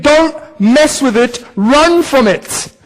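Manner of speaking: slow, deliberate warning; a preacher urging the church to flee sin; slow emphatic warning. Real, not acted. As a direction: press each word home slowly. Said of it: "emphatic exhortation".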